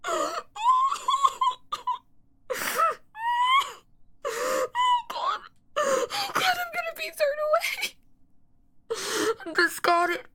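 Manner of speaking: high, wailing, broken cries; despairing sobs. Acted. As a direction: fall apart in tears. Despairing sob at being discarded.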